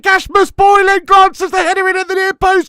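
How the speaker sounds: screaming with joy as the ball hits the net; real goal celebration yell; ecstatic goal shout. Real, not acted.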